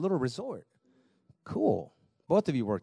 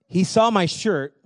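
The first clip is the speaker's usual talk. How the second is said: normal talking voice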